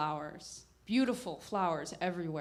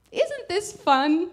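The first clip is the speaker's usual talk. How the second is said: much higher, louder, slow delighted question; delighted aunt; 10 semitones higher, 11 dB louder, slower than usual. Retold or imitated. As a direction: let the aunt's childlike delight ring out. Aunt's delight over documentary.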